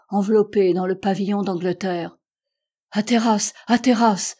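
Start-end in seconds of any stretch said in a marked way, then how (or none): 2.9-4.4 rising cry of a friend's name, louder on the second. A narrator's reading. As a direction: call out in alarm, louder each time. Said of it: "s'écria le docteur".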